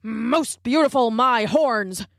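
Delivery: scornful grunt. Acted. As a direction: throw her words back in disgust. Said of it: "grunted a wildebeest".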